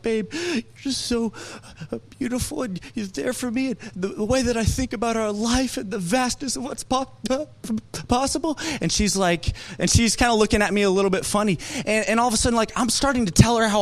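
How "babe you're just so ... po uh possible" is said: higher, softer, tearful confession; choked up. Retold or imitated. Pastor retelling crying while praising his fiance, softer and choked. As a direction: voice catching with emotion. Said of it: "and I'm like".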